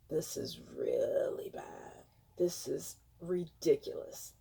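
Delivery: hushed grave whisper; grim whisper. Real, not acted. Reacting to death numbers.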